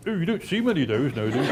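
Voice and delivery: pursed-lipped, small-mouthed frog voice; frog shrinking its mouth. Acted. Frog answering a frog-eating crocodile, pursed small-mouthed voice. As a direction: squeeze the words through a tiny puckered mouth. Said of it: "the wide-mouthed frog says".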